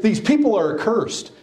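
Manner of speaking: preacher mimicking the Pharisees' scorn, pitched high; mock superiority. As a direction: sneer down at the crowd.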